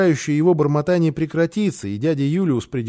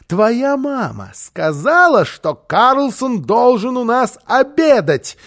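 higher, slow, emphatic demand; indignant interrogation; 7 semitones higher, 4 dB louder, slower than usual. Acted. Housekeeper questioning the boy about lunch, indignant. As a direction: press each word slowly, pitched up.

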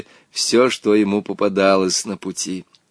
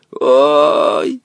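a hint of an old man's rasp; lightly voiced old man croak; boy aging fast, croaking like an old man; quavering old man; 3 semitones higher, 5 dB louder than usual. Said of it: "прохрипел ... дребезжащим, стариковским голосом".

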